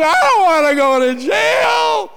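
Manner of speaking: high, loud, wailing plea; hammed-up blubbering cry; mock bawling. Preacher acting out bawling on the couch to his congregation, loud wail. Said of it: "I was bawling".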